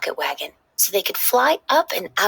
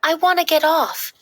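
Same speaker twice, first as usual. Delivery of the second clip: shouting; 7 semitones higher than usual. Acted. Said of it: "Riley shouted".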